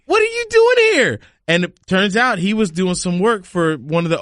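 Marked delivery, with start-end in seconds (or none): 0.0-1.2 high, astonished question; can't believe he's here; amazed high-pitched disbelief; stunned surprise. Retold or imitated. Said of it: "And I was like".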